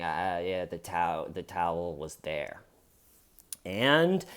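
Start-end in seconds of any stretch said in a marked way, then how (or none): 0.0-2.6 miserable shaky suspect. Retold or imitated. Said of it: "he's just shaking".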